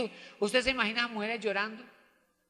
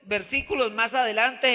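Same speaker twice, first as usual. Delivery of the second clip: ordinary talk, nothing marked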